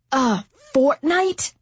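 high, slow outraged question; appalled disbelief; incredulous. A narrator's reading. Duke outraged by demand.

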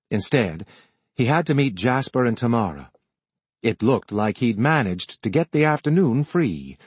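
plain reading voice

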